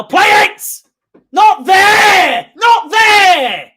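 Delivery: very loud, very high shout at the players; frantic yell. Real, not acted. Fan screaming at the players through the TV, frantic. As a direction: scream the instruction at the screen.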